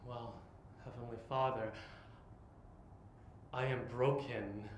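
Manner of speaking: ordinary delivery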